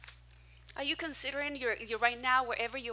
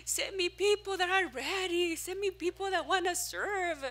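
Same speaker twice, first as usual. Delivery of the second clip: pleading prayer; 7 semitones higher than usual. Retold or imitated. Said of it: "And I'm crying".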